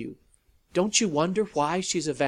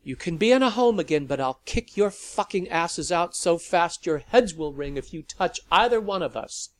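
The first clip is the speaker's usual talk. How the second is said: threatening; 4 semitones higher, 8 dB louder than usual. A narrator's reading. Sally threatening the vampires.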